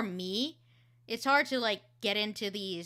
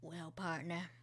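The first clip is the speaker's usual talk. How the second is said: a weary cowboy at the end; quiet gunslinger voice; 6 semitones lower, 11 dB quieter than usual. Shown as a demonstration. Cowboy character in reel.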